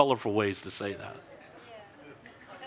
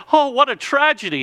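mock lament; 11 semitones higher, 6 dB louder than usual. Townspeople lament the broken leg.

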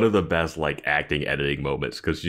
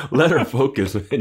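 high, louder, laughing plea; laughing loud exasperation; 7 semitones higher, 5 dB louder than usual. Real, not acted. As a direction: cry it out through laughter. Repeats louder while laughing.

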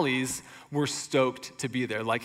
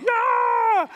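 much higher, louder cheer; mock crowd roar; 5 dB louder than usual. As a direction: whoop like a rally crowd. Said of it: "the people were like".

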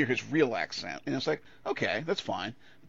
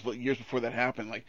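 normal talking voice

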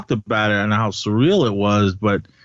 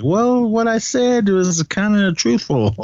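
much higher-pitched mock excuse; Obi-Wan's excuse; 11 semitones higher than usual. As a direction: quote it high, as a playful wriggle out of a lie. Retelling Obi-Wan's excuse for lying.

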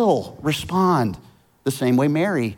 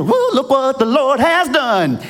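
much higher, joyful whoop of thanks; excited praise; 7 semitones higher, 3 dB louder than usual. Retold or imitated. Prize winner whoops praise after the surprise check, excitedly. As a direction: celebrate the win out loud. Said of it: "And they're excited".

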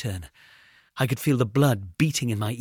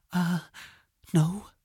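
nervous; 7 semitones higher than usual. A narrator's reading. Frightened boy answers.

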